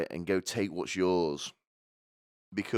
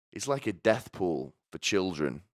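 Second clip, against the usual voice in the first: ordinary delivery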